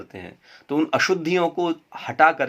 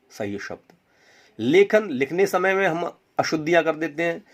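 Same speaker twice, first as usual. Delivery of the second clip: normal talking voice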